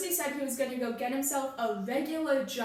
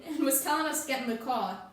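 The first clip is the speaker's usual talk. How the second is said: ordinary talk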